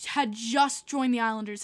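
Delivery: ordinary talk, nothing marked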